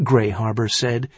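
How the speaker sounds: usual speaking voice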